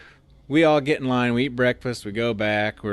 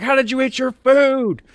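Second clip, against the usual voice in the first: drill sergeant yell; 8 dB louder than usual. Retold or imitated. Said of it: "He's like".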